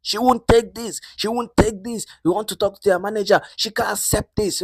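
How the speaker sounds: very high-pitched indignant protest; mock shouting. Latifa demands the manager, shouting her refusal. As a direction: act out a loud, high tantrum of refusal. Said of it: "Latifa was shouting".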